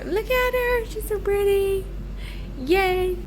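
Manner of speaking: gushing high and thrilled; excited high voice. Real, not acted. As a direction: squeal over the new fridge. Admiring the new fridge.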